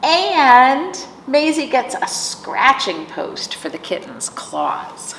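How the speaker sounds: normal talking voice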